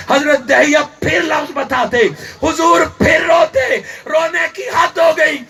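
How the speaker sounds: impassioned preaching. Acted. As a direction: cry it out to the congregation with fervour. Preacher retells at full force.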